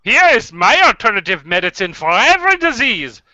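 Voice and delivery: put-on swooping, theatrical German accent; cartoony German accent. Doctor pitching alternative medicine, cartoony German accent. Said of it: "the most cartoony German accent".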